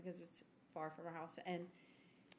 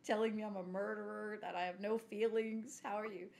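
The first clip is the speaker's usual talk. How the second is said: usual speaking voice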